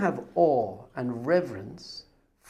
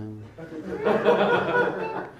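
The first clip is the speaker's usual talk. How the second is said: high laugh. Real, not acted. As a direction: burst of high real laughter. Speaker laughing at own story.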